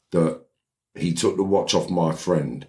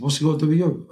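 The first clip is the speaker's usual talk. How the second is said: high-pitched defensive comeback; indignant retort, pitched up; acted retort; 10 semitones higher, faster than usual. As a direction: play the other man brushing off a challenge. Retort repeated in quarrel.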